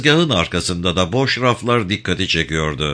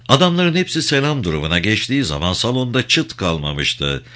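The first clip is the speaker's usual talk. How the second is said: plain reading voice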